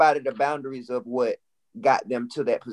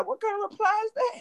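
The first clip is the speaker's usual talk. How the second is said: incredulous question; 5 dB quieter than usual. Real, not acted. Pushing back on his answer.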